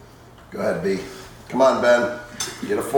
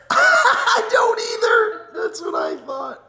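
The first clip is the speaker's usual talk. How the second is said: high squealing laughter through words; laughing. Real, not acted. Laughing at the wasabi reactions.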